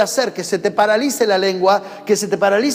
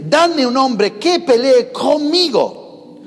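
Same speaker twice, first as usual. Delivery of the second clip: slow, higher, deliberate reading of the verse; solemn scripture reading; 6 semitones higher, 4 dB louder, much slower than usual. Shown as a demonstration. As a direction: read the verse aloud slowly, as scripture. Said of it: "versículo diez dice".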